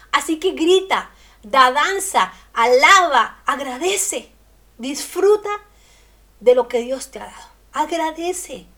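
loud, rousing call to praise; rally the listeners to shout and dance; full-force preacher's exhortation; fiery preaching. Acted.

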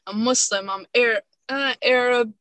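usual speaking voice

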